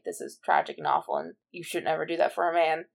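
normal talking voice

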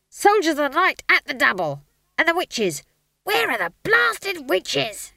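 high-pitched, exasperated yelling; scream of rage. A narrator's reading. Stage manager calling for missing actors, yelling in rage. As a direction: bellow orders backstage. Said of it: "traditional scream of rage".

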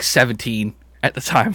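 usual speaking voice

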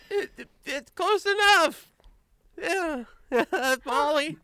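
very high, squeaky nervous gnome voice; anxious little gnome. Acted. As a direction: stammer in a tiny high gnome voice.

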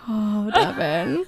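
very slow, drawn-out Oh, Devin; mock disappointed. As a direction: let the disappointment drag out. Disappointed at boyfriend.

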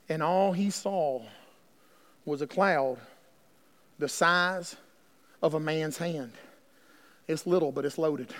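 quiet, slow, intimate preaching; hushed sermon telling. Acted. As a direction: lean in and murmur it.

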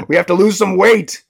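acted parental insistence; insistent mother. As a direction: lay down the law, high and loud. Elephant mother overruling her children.

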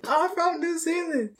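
high, slow, giggling denial; laughing joke. Pretend. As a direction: laugh out the fake origin. Joking denial while laughing.

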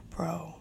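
a long breathy sigh, then a whispered bro. Real, not acted. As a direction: exhale in disbelief and whisper bro. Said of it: "I was like".

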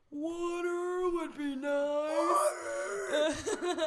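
high, drawn-out wailing plea; hammed-up crawl-and-beg wail; dramatic mock pleading. Crawling to parents for water, dramatic mock wail. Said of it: "all dramatic".

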